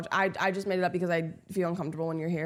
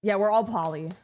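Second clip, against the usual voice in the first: ordinary talk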